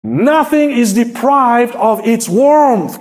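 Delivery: psalm read out with rising fervour; fervent Bible reading from the pulpit; scripture reading. Acted. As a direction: proclaim the verses like a preacher. Reads the psalm aloud.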